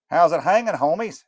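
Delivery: a burned-out rock star calling out; aging rocker. Acted. Rocker greeting the fudge makers, loud and high.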